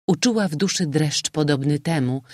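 usual speaking voice

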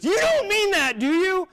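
high, loud, squeaky disbelief; incredulous roommate. Retold or imitated. Roommate gasping at him, high and loud disbelief. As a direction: pitch way up in shocked disbelief. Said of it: "He goes".

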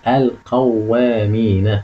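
slow word, long vowels in القوامين; drawn-out model reading. Shown as a demonstration. Teacher shows students the word to analyse, slow drawn-out reading. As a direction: stretch القوامين out syllable by syllable. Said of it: "لاحظ كده أنا جبت لك إيه؟".

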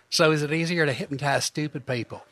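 lightly put-on Southern accent asking; a hint of a Southern accent. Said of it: "I always do a Southern accent".

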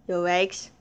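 ordinary delivery

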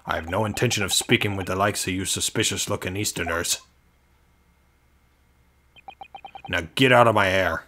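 lightly done Scottish governor, dismissive; attempted Scottish accent. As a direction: brush them off in rough Scottish. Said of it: "I feel like he's Scottish".

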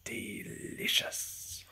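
very slow savouring whisper; whispered relish. Acted. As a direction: draw it out, whispering greedily. Fox savours stolen egg.

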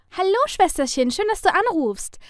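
high, loud, delighted greeting; joyful. A narrator's reading. Younger sister answers the phone, full of joy. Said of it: "mit Freude in der Stimme".

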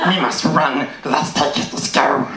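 a hint of Gollum, raspy and hushed; half-whispered Gollum, scurrying off. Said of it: "Perfect time for the Gollum impression".

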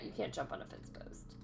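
quick whisper of a rule; fast hushed whisper; whispered aside. Real, not acted. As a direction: toss it off under your breath. Explains the block rule.

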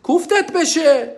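high, slow scolding voice; disgusted scolding. Pretend. Scolding a glutton stuffing his face, disgusted and drawn out. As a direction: drag it out in disgust. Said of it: "When someone's gluttonous".

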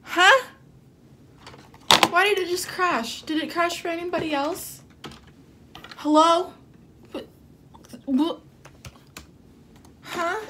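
sharp, high, loud huh; loud confusion. Real, not acted. Fan yelling at her crashed laptop in confusion. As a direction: blurt it out, baffled the stream crashed.

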